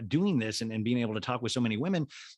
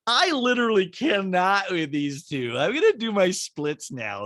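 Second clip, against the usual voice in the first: high, loud mock exasperation; hammed-up impersonation of a fed-up onlooker; Kyle; 7 semitones higher, 5 dB louder than usual. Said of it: "Kyle watching the two ladies and going".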